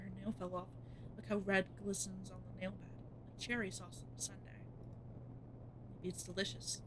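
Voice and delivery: quiet, even creepy narration; soft storyteller voice; horror story narration. A narrator's reading. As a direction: read it like a dark tale. Narrates horror game text.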